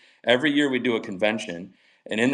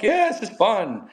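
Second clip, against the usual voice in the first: mock cheerful; 10 semitones higher, 3 dB louder, slower than usual. Mocking naive first packet attitude.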